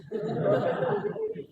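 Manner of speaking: a short high-pitched giggle. Real, not acted. Preacher giggling at the goat-belly joke, high giggle. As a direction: giggle at his own joke.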